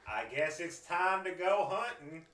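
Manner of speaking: high, loud, slow mock dismay; eager Jensen. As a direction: ham up the guard's fake worry. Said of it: "Jensen would be, like".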